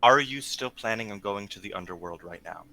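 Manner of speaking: normal talking voice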